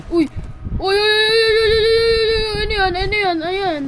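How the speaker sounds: high loud frantic cries; panicked. Real, not acted. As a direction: panic as the enemy rushes in. Panicked shouting in game.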